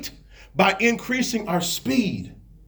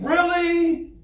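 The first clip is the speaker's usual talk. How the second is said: high-pitched, exasperated disbelief; mock-outraged and high; incredulous; 7 semitones higher than usual. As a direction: throw it out as an indignant question. Moses acted in exasperated disbelief.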